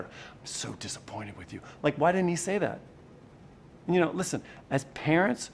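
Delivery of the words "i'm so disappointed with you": hushed, low parental disappointment; soft, lowered voice of a disappointed parent. Acted. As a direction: drop right down into quiet, let-down reproach. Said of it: "it's what you say to your kid".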